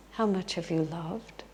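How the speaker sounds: soft, unhurried question; gentle, hushed insistence; Jesus repeating patiently. Retold or imitated. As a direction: ask it quietly, without arguing. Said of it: "He just says again".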